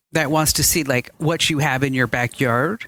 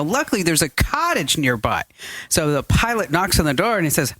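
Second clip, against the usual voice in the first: usual speaking voice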